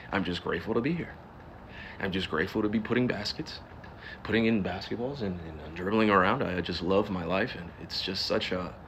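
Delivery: soft, polite private-school interview voice; subdued, rehearsed press-conference humility; fake media voice. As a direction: play the grateful athlete for the cameras, softly. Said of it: "you hear me talk like I come from a private school".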